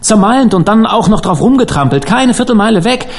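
high-pitched, overwrought outpouring; hysterical. A narrator's reading. Dan tells Harvey of the wreck he witnessed, hysterical. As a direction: pour it out in panic, pitch climbing to breaking off. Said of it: "sagte Dan hysterisch".

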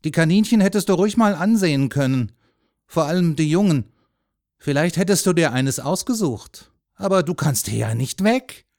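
loud, animated regret; man tempting a boy. A narrator's reading. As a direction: dangle the rabbits before him.

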